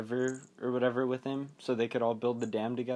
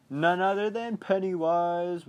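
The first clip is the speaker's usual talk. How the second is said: slow, loud, high mock announcement; dramatic reveal; 6 semitones higher, 5 dB louder than usual. Viewer recognizing the villain, slow hammed-up reveal. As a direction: draw out the villain's big entrance.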